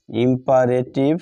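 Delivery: ordinary delivery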